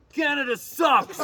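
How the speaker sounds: loud, slow jeering yell from the stands; heckler's shout. Retold or imitated. A fan in the stadium heckles the singer, yelling. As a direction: bellow it like a rowdy fan. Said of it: "somebody in Levi's Stadium goes".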